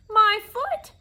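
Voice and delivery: very high, loud yelp of outrage; shouted outcry. A narrator's reading. Bee struck on the foot by the cane, shouting. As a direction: yell it high and hard. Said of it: "shouted the bee".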